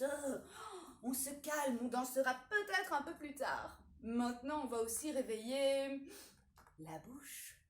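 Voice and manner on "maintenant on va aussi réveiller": usual speaking voice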